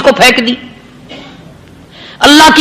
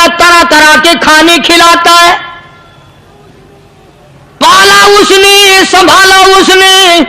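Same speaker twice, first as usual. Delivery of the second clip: higher, slow, hammering each phrase home; fiery preaching; 4 semitones higher, slower than usual. Acted. Preacher rebukes worshippers who forget their Master, preaching hard. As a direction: preach it to the congregation with force.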